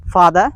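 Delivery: "father" ending in an open "uh", no R; non-rhotic "father"; dropped R. Shown as a demonstration. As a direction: say "father" British-style, dropping the final R. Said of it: "they all time drop the sound R".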